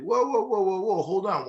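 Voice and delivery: a Southerner slamming on the brakes; the South stopping California's free-state bid with alarm; mock southern protest. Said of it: "pro-slavery Southern state people are gonna be like".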